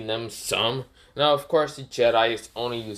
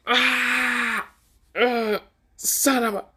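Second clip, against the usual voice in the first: very high scream then strangled curse; hammed-up pained scream, curse cut short; 13 semitones higher, much slower than usual. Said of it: "the Jedi screams".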